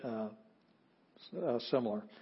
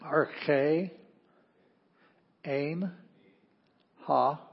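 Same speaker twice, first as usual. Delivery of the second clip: slow choral reading of Greek, one word at a time; slow recitation, word by word; much slower than usual. Shown as a demonstration. Teacher leading the class through John, slow recitation. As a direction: pause after every word so the class can repeat. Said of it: "let's say it together".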